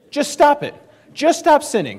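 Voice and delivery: high, loud exasperated yell; yelling preacher. Retold or imitated. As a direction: shout it at the congregation like you've had enough. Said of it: "he just starts yelling".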